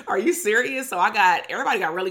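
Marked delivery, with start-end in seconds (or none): 0.1-0.9 incredulous reply. Retold or imitated.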